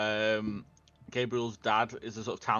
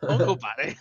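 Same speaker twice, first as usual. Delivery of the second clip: Uncle Paddy in a hammed-up bad Irish accent, a bit louder; mock Irish brogue on Uncle Paddy, done badly on purpose; awful Irish accent; 7 dB louder than usual. Shown as a demonstration. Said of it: "I say it in an awful Irish accent".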